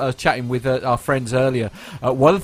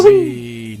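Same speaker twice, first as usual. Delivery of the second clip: higher, louder mock cheer; mock whee; 9 semitones higher, 7 dB louder than usual. Host cheering the Emirates reveal, hammed-up whoop.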